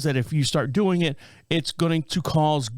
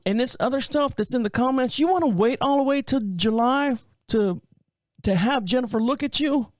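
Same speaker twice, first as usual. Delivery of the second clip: higher-pitched woman's phone voice; imitated receptionist; 8 semitones higher than usual. Office caller questioning the wait until July, imitated higher voice. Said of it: "I get a girl on the phone".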